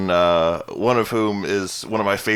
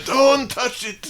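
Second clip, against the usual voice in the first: put-on very high, slow old man warning; fussy grandpa scolding; much slower than usual. Voicing the grandparent.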